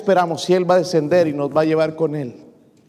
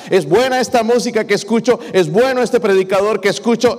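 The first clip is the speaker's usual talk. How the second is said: full-force preaching; 5 semitones higher than usual. Acted. Pastor urging the church to seek discernment, rising preaching. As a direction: drive it home to the congregation. Preaching climax, louder and higher.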